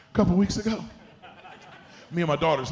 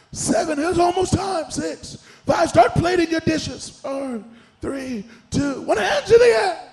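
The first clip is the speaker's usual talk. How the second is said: high-pitched imitation of a cooking-show countdown; 13 semitones higher, 8 dB louder, slower than usual. Host counting down cooks, mock urgent calls. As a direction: call out the last seconds, climbing high. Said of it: "they start counting down".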